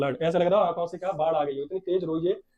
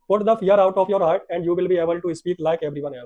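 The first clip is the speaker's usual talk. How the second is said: slow, emphatic English read-aloud; read-aloud story; 5 dB louder, much slower than usual. A narrator's reading. Teacher reading the English lesson to the class, slow story reading. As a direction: read the lesson out loud and very slowly.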